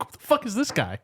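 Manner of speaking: high-pitched mock bewilderment; baffled onlooker. Podcaster voicing medical people at the intruder, baffled.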